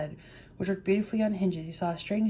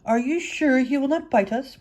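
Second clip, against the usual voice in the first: higher, mildly timid mouse question; 5 semitones higher, 4 dB louder than usual. A narrator's reading. Mouse queen asking about the dog, mildly timid. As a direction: ask with a touch of worry, pitched up. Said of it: "asked in a timid voice".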